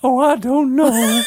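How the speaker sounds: high, slow, shouted flapper voice; 1920s flapper. Pretend. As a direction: gush it out like a flapper. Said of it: "It reminds me of your, the fla-, fl-, flapper".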